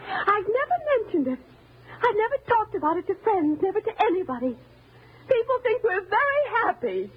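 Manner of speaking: high, overwrought outpouring; anguished, high-pitched reproach; distraught confession. Acted. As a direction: let years of fear spill out. Confesses years of fear of him.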